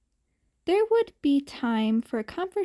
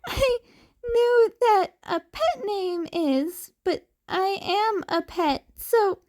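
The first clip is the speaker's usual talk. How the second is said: put-on laughing kid; 8 semitones higher than usual. A narrator's reading. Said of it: "he started laughing again".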